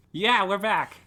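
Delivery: high, loud call. Real, not acted. Guest calls the co-host back in, loud and high. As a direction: announce the return loudly.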